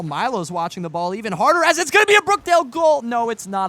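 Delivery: sudden high loud excited call; calling a goal that isn't, voice soaring; overexcited caster jumps the gun on a goal; too excited. Real, not acted. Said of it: "I got a little too excited".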